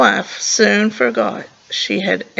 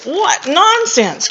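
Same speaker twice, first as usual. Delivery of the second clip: high, slow indignant cry; a hint of an outraged queen; 7 semitones higher, much slower than usual. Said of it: "cried the queen".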